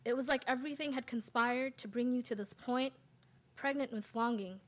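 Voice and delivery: measured poem reading with pauses between phrases; poetry recital. Acted. Poet reads own piece to an audience, measured recital. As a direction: recite in weighted, separate phrases.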